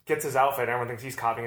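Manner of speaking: normal talking voice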